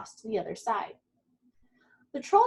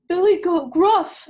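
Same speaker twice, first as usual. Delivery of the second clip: higher, louder middle goat; middle-sized goat voice; 7 semitones higher, 11 dB louder than usual. A narrator's reading. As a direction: announce himself as the middle brother. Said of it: "in his middle-sized voice".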